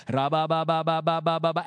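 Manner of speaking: imitated rapid-fire babble, loud and monotone; loud praying in tongues. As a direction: mimic dad's loud prayer in tongues. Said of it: "And he was loud too".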